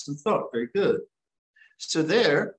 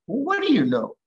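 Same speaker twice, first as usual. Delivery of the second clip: high, louder mock challenge from a curious student; imagined questioner; 10 semitones higher, 4 dB louder than usual. A student challenging the teacher, in a high, mock-questioning voice. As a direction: toss the question back like a skeptical listener. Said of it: "If you say to me then".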